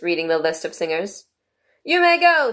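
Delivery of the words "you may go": high, loud royal dismissal; King. A narrator's reading. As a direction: dismiss them in a ringing kingly voice. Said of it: "said the King".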